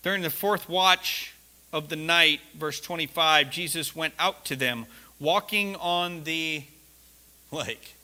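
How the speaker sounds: slow, soft reading of the verse; scripture reading. Acted. The preacher reads Matthew to the congregation, slow and hushed. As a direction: read the verse with reverence.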